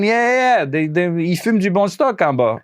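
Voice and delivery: high, teasing Quebec French voice; imitating Quebec guy. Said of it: "he goes again".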